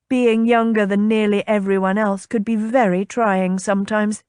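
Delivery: usual speaking voice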